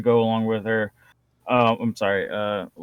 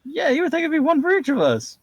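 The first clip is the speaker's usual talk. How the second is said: sarcastic high voice; 13 semitones higher than usual. Retold or imitated.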